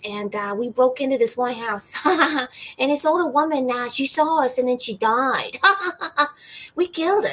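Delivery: imitating a weird open-mic comic's confession, high and cartoonish; imitating the weird guy. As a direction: tell a crime story in his strange goofy voice. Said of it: "he has a voice like this".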